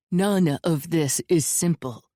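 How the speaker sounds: ordinary delivery, nothing marked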